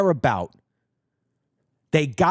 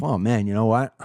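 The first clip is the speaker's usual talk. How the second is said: mock Trump musing; 9 semitones lower than usual. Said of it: "sit there and say to myself".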